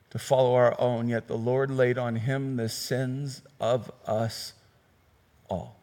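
low, soft, slow reading of a prophecy; solemn scripture reading. Acted. Preacher reading Isaiah to the congregation, hushed solemn reading. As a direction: read it hushed and reverent.

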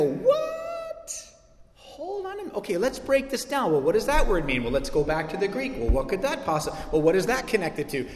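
the keen student pulling every word apart; eager theology nerd. Retold or imitated. Pastor imitating theology nerds studying a verse, eager. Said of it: "what all the theology nerds do, is they go".

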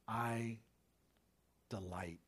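soft, low, hushed loving words; tender father. Retold or imitated. Good dad hugging his hurting child, hushed and tender. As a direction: fade to a whisper of love.

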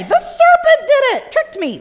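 high and loud whining excuse; mock whiny excuse. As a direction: squeal it like a caught child. Eve blaming the serpent.

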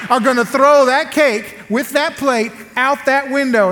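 incredulous arguing. Retold or imitated. Husband sure wife won't throw cake out window, incredulous. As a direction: argue it out, hammering the last words. Acted quarrel over the cake.

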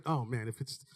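low, soft pampering voice; coddling mother. Retold or imitated. Mother excusing her son from hard things, soft. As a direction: let him off easy, gently. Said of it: "I was like".

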